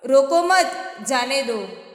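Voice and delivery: "रोको मत" firmly together, pause, then "जाने दो"; group "रोको मत", break, let "जाने दो" follow; pause after मत. Shown as a demonstration. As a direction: stop after "मत" before saying "जाने दो". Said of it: "उनको रोको मत जाने दो".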